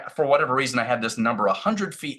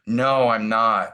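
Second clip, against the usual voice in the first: slow realization; 4 dB louder, much slower than usual. Retold or imitated. Realization after the gasp.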